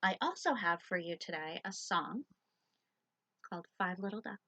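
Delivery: plain reading voice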